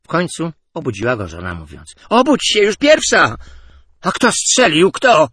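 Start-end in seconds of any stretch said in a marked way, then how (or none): 2.1-3.4 much higher, louder call; waking someone. A narrator's reading. Wife waking her dozing husband, loud and high. 4.0-5.3 high, loud startled question; startled cry. A narrator's reading. Sportscaster jolted awake, shouting high and loud. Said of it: "zawołał Ciszewski".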